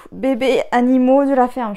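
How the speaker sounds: shaky voice. Real, not acted. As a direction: voice trembling like a goat. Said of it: "j'ai ma voix qui tremble comme une chèvre".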